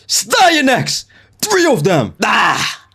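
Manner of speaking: very high-pitched, drawn-out shout; excited yell. Pretend. Streamer calling out a name in the game, yelling. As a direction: belt it out high, ending in a yell.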